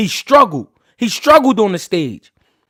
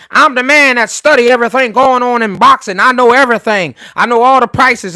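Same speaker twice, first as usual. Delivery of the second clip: loud, raised-pitch mimicry of a bragger; mock boasting; 4 semitones higher, 7 dB louder than usual. Streamer mimicking a boxer's father bragging, mock boasting. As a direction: imitate a know-it-all at full volume.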